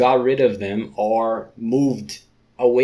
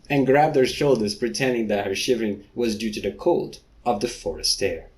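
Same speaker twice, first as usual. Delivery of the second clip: ordinary talk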